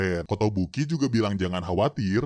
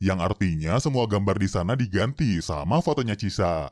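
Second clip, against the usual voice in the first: usual speaking voice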